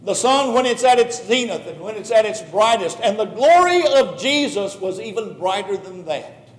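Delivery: ordinary talk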